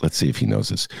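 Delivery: ordinary talk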